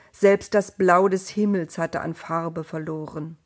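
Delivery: plain reading voice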